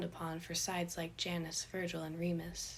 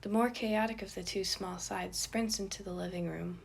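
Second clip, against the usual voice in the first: usual speaking voice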